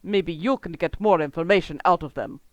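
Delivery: grumbling villain complaint; grumpy. Acted. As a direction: say it sourly, fed up. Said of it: "the Magentalist says grumpily".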